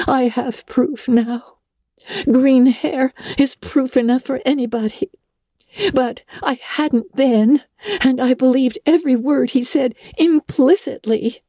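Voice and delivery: put-on girl ruefully owning she was fooled. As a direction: admit it with a sigh. Anne's rueful reflection.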